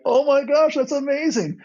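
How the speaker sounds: high-pitched retold delight; excited gushing. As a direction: gush like you just heard great news. Retelling their joy at James's news.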